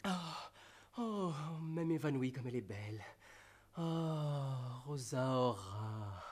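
hammed-up swooning lover; overacted death scene. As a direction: swoon dramatically, sighing. Lover swoons over Rosaura.

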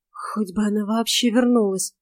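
ordinary delivery, nothing marked

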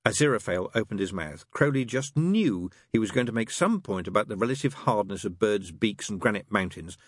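ordinary delivery, nothing marked

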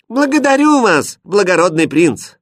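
high, loud, over-bright formal reply; loud thanks. A narrator's reading. Princess startled into thanking the prince, loudly. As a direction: call out the thanks at full volume. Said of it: "громко сказала".

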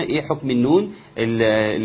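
usual speaking voice

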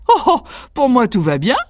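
lightly hammed cocky tailor; breezy tailor. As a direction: shrug off the danger. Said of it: "répondit le tailleur".

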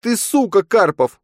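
shrill, loud insulting shout. Acted. Nastya screams an insult at her fiancé Karpov. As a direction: hurl the insult at him, high and loud. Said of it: "крикнула Настя".

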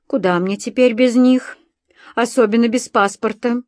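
ordinary delivery

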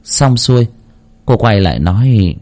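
usual speaking voice

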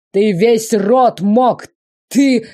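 loud, slow, high furious yelling; furious shout. Acted. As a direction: shout at him, choking on rage. Emperor enraged.

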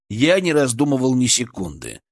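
usual speaking voice

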